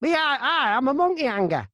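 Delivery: high, broad Hartlepool voice; Hartlepool accent. Acted. Block supervisor from Hartlepool introducing himself, broad Hartlepool accent. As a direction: declare it as a Hartlepool local, pitched well up. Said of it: "that's how he, he talks".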